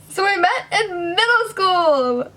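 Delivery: high, loud blurt; awkward exclaim. Real, not acted. Vlogger restarting her intro, a loud awkward blurt. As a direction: blurt it out brightly, a bit too much. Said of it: "Did I already say that?".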